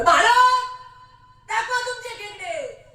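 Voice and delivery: high loud official's call. Retold or imitated. Foreign official at the airport calls France to show crabs, loud call. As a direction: call the next one forward loudly.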